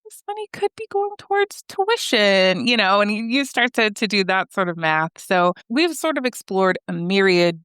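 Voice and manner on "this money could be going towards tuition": high voiced inner thought; worried inner voice. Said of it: "and you're thinking".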